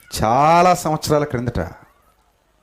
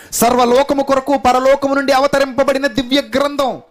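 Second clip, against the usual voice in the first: preacher crying out to the church for the word, pleading preaching; 7 semitones higher than usual. Acted. Pleading sermon climax.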